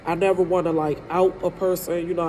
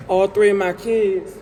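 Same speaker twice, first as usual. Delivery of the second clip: choked up, real tears. Real, not acted. Breaks down over her kids.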